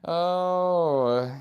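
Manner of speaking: long, high, loud "oh"; loud tired groan. Real, not acted. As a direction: let out a big drawn-out tired moan. Tired groan after workout talk.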